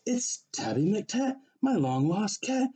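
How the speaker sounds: joyful recognition. Acted. Fred finds his lost cat.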